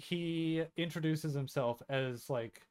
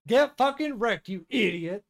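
much higher and louder, yelling in victory; triumphant shout; 12 semitones higher, 12 dB louder, slower than usual. Real, not acted. Cheering the heroine's comeback line, a triumphant yell. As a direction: roar it like a knockout blow.

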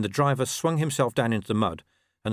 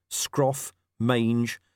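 ordinary delivery

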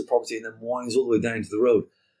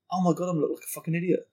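panicked thought; 6 semitones higher, faster than usual. Retold or imitated.

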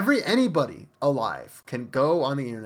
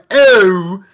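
loud mock English accent; bad English accent; 4 semitones higher, 13 dB louder than usual. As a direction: lurch suddenly into a loud, fake English accent. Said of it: "they'll remember what they're supposed to be doing".

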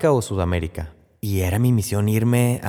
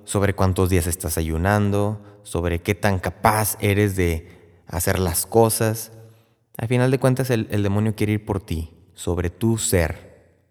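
normal talking voice

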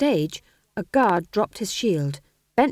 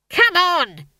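high, loud furious order; scream of rage; 11 semitones higher, 8 dB louder than usual. A narrator's reading. Stage manager rounding up his cast, screaming in rage. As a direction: yell like an enraged stage manager. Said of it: "traditional scream of rage".

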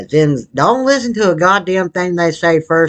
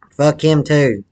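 ordinary talk

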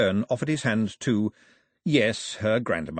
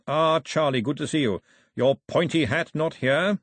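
put-on louder, higher chummy old clergyman; bishop, hearty; 4 semitones higher, 4 dB louder than usual. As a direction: greet an old pal with blustering cheer.

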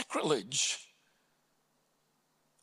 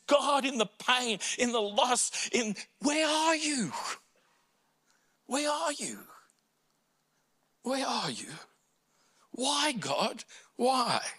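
much higher, fading pleading cry; anguished cry; 8 semitones higher than usual. Retold or imitated. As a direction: cry out where are you, breaking off. Voicing Jesus' anguish.

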